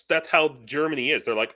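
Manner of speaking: normal talking voice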